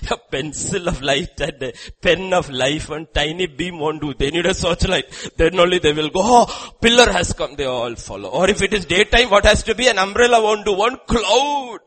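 loud, high, driving sermon delivery; forceful preaching. Real, not acted. Preacher on what the blinded masses need, forceful preaching. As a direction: preach it hard and loud to the back rows.

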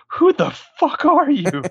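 high, slow mock outrage at strangers; shocked yell. Dyson family confronting John and the Terminator, high mock shock. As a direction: squeal it like a startled homeowner.

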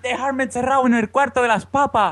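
put-on high, pleading little kid; as a boy begging to be shut in the potato store; whiny child. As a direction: beg to be locked away like a scared child. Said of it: "Y decía".

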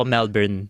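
'Melbourne' with every syllable fully sounded; old newcomer pronunciation. Shown as a demonstration. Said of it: "Nung nagsisimula ako, lagi ako".

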